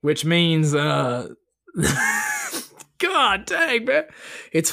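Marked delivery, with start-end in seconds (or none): 1.8-2.6 high wheezing laugh; helpless laughter bursts out; cracking up. Real, not acted. Laughing fit over bad math.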